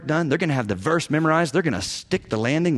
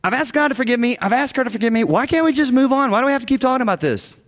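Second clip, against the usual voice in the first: whiny unrepentant spouse; 8 semitones higher, faster than usual. Retold or imitated. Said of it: "and you're like".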